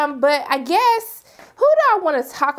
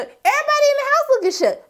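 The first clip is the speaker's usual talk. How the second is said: shocked; 13 semitones higher than usual. Retold or imitated. A viewer reacting to the shocking scene, high shocked voice. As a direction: rise up in disbelief.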